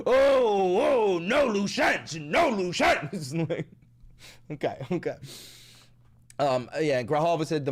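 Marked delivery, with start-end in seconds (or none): 0.1-3.3 slow, drawn-out menacing villain; hammed-up killer taunting his victim; mock villain. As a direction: savour each word like a movie killer. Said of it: "the camera cuts and then".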